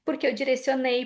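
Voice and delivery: ordinary talk, nothing marked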